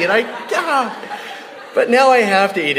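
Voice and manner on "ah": normal talking voice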